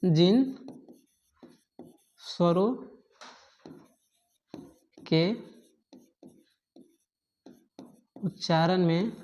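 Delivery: word-by-word dictation with long pauses. Real, not acted. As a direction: dictate slowly for note-takers, a few words at a time.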